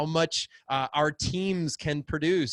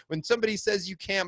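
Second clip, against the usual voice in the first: ordinary talk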